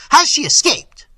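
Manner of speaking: loud, high-pitched demand; alarmed cry. A narrator's reading. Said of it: "cried the prince".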